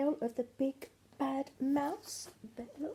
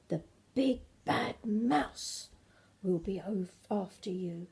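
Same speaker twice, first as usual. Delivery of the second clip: spooky storyteller; 2 semitones lower than usual. Acted.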